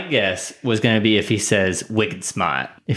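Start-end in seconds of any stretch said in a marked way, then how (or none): 1.9-2.7 put-on Boston accent, R dropped in "smart". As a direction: say it like a Boston local. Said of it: "who I base all my Boston or Massachusetts accents".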